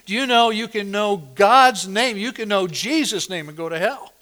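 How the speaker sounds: loud, ringing pulpit delivery; thundering sermon voice; full-force preaching. Acted. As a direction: proclaim it to the whole congregation.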